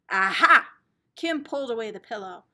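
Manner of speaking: plain reading voice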